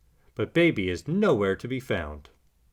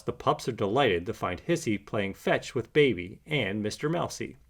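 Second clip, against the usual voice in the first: plain reading voice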